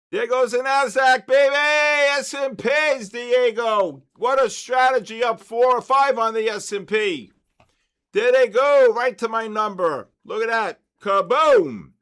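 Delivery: loud, high triumphant shouting as the market jumps; roaring celebration at the screen; ecstatic cheering. Real, not acted. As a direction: yell it out like your team just scored.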